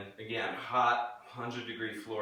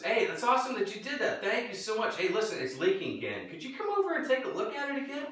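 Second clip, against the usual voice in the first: much higher, sugary friendly voice; mock friendly dad; 9 semitones higher than usual. Dad faking thanks to the neighbor kid, high and sugary. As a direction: fake cheer, pitched up high. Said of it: "my dad says".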